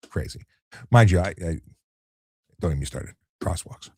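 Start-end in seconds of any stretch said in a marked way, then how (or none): none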